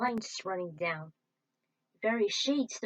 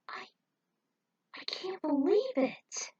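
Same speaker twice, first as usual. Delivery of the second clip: whispered, higher, slow; hushed disbelief at the creature; awed whisper; 9 semitones higher than usual. Acted. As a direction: breathe it out in awe. Awestruck at the creature.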